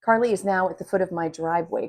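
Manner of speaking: plain reading voice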